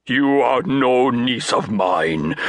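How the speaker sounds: slow, pompous declaration; lightly pompous uncle; grandiloquent. A narrator's reading. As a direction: declare it with mild pomp. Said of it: "he announced grandiloquently".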